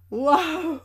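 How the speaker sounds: high, loud cry of delight; excited wow. Real, not acted. Opening boosters, she cries out in delight. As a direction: let out a thrilled yelp of wonder. Thrilled card opening outburst.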